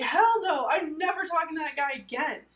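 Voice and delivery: high, loud burst of refusal; hammed-up adamant no; emphatic retold refusal.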